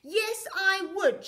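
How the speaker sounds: sure of herself, slow and firm; insist, calm and certain; confident. A narrator's reading. Rocket answering the shuttle, confident and firm. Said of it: "she said confidently".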